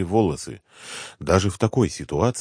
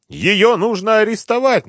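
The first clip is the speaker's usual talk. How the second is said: very high, loud frightened insistence; испуганно настаивает; 6 dB louder than usual. A narrator's reading. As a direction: insist in alarm at a squeal. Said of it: "настаивал испуганный депутат". (испуганно, высоким криком)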